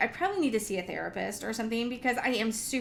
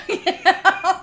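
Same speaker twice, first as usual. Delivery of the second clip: ordinary delivery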